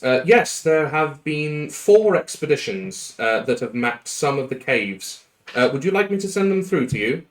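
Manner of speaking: high, eager clerk voice; keen assistant reporting over the intercom=41; agent reply. Acted.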